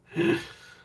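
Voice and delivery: high, sudden shriek of laughter. Real, not acted. Shrieking with laughter at a viewer's name. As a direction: burst into a squeal.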